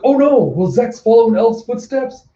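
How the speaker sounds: high, loud mock-alarmed commentary; hammed-up announcer suspense; dramatic announcer. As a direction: cry out like a shocked commentator. Announcer frets over fighter's shots.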